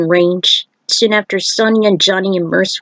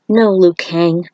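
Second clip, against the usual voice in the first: ordinary delivery, nothing marked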